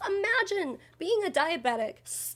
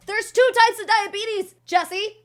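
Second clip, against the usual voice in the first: indignant correction; 8 semitones higher, 5 dB louder than usual. Real, not acted. Rebuts, high and louder.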